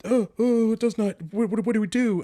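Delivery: high, loud, flustered mock panic. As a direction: flap about like nobody knows what to do. Panicked staff in chaos.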